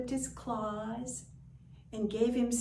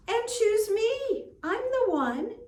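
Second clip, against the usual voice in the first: pushy cat; 9 dB louder than usual. Acted. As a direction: push to be picked, high and loud. Cat pushes for attention.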